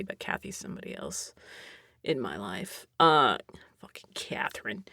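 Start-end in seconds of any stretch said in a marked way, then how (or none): 3.8-4.8 whispered curse, much softer. Real, not acted. Cursing the neighbor to viewers, whispered. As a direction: hiss it under your breath.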